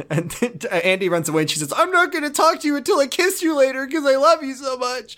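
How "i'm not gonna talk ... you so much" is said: high-pitched, gushing mock declaration; mock lovesick girl. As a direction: gush it in a much higher voice. Mocking Andy's lovesick exit.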